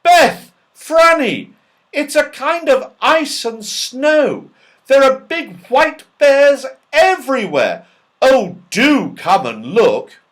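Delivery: loud high call across the snow; shout. A narrator's reading. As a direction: shout for the girls in wonder. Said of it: "He gave a shout".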